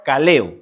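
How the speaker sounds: loud "Kaleo", accent on the middle E; stress on the E. Shown as a demonstration. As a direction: say "Kaleo" firmly, leaning on the E. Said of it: "con acento en la E".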